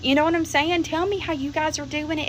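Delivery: ordinary talk, nothing marked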